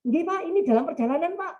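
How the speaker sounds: high, eager phone answer; eager reply. Retold or imitated. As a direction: reassure the father-in-law brightly. Groom assuring father-in-law on phone. (menjawab telepon mertua dengan semangat)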